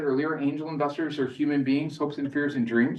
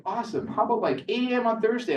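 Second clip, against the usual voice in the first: much higher, bright eager voice; eager investor; 10 semitones higher than usual. Retold or imitated. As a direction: sound keen to lock in the meeting. Investor eagerly books a meeting.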